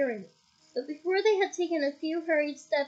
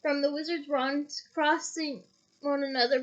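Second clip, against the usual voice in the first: plain reading voice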